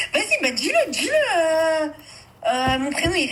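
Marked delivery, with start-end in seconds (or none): 0.1-1.9 high, laughing mock insistence; imitating his insistence. Retelling the boyfriend's pushy line to a friend, laughing. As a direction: replay his pushy demand while laughing. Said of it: "je te répète".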